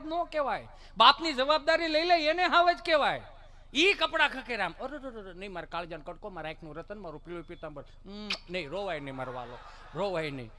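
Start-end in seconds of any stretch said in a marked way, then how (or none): 4.8-10.5 low, soft doting murmur; acted gentle comforting; soothing a child. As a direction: hush a crying little one tenderly.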